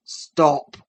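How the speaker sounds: loud sudden low command; sharp cry. Acted. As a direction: bark it out. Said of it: "cried his father".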